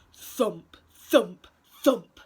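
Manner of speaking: heavy, separate thump sound words. A narrator's reading. Storyteller voices banging on the door, thumping. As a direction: land each thump like a blow.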